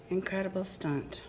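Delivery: ordinary delivery, nothing marked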